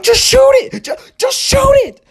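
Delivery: very high, loud panicked shout. Retold or imitated. Boy screaming at his neighbor to shoot the attacking dog. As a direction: beg them, screaming.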